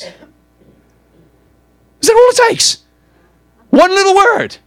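high-pitched, drawn-out cry; anguished outcry. Acted. Preacher challenging the congregation over turning from Jesus, crying out. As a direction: cry it out loudly, stretching the words.

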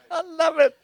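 laughing high and delighted. Real, not acted. Preacher delights in congregation's response, laughing. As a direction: laugh through it with joy.

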